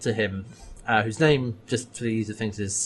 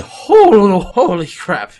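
much higher and louder, stunned; shocked exclamation; 8 semitones higher, 11 dB louder, slower than usual. Retold or imitated. Dargo reacting to Kelsa's request, stunned. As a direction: blurt it out in sheer shock.

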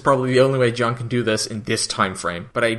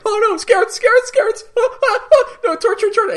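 very high, shrill-pitched hammed-up panic with laughter breaking through; panicked Stark; 4 dB louder than usual. Stark panicking when Dargo says the Scarans are coming, hammed-up high panic. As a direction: squeal it like a terrified madman, laughing. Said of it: "he's like".

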